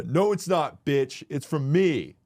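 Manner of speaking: high, loud snap; mock indignant retort. Uncle correcting niece, loud and indignant. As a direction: claim the credit loudly.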